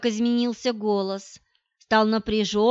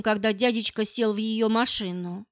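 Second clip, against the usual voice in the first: usual speaking voice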